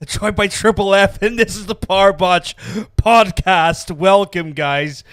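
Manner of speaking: loud, slow show announcer. Acted. Host booming the podcast name to his audience, announcer style. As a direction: boom out the show's name to the listeners.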